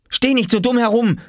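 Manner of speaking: put-on high, cross boyish outburst; scolding. A narrator's reading. Oskar to Lars who just watches, scolding. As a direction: snap at a useless onlooker, high-pitched. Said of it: "schimpfte Oskar".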